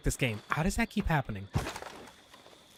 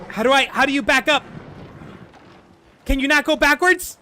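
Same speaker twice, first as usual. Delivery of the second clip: frustrated shouting; 8 semitones higher, 5 dB louder, faster than usual. Real, not acted. Can't get the wagon to reverse.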